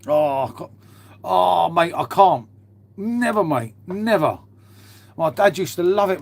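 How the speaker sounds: ordinary talk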